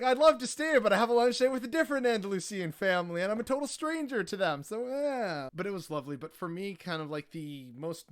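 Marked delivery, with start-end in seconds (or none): 0.0-4.6 loud, high, fast and amused; excited excuse. Retold or imitated. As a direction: gush the excuse out in disbelief. His own amused excuse.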